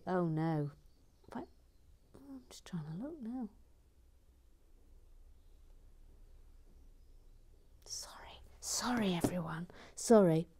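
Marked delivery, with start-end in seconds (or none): 2.1-9.2 whispering. Real, not acted. Hushed aside while checking.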